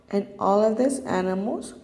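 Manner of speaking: ordinary talk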